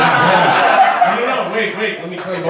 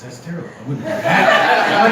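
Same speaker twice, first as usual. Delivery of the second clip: ordinary talk, nothing marked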